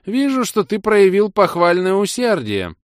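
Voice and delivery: put-on high, slow, warmer old mage. A narrator's reading. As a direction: warm up to the student. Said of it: "Голос мага явственно потеплел".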